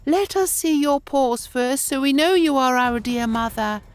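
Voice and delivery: put-on high children's voice asking; cautious goat kids. A narrator's reading. The kids ask the wolf to show his paws, high and careful. The little goats asked.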